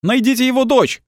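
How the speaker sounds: яростный высокий крик; ори во всё горло, очень высоко; ор из толпы. A narrator's reading. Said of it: "заорал кто-то". (заорать из толпы)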